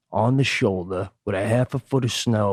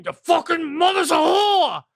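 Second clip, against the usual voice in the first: mechanic cursing. Retold or imitated. Burly mechanic curses the stuck lug nut, high mock yell. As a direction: bellow it like a frustrated mechanic. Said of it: "And he's cursing".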